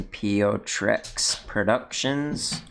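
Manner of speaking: Pio Trex Productions sounded out slowly, syllable by syllable; unsure pronunciation. Real, not acted. Reviewer reading out a creator name he can't pronounce, slow and careful. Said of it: "I can't really pronounce that name".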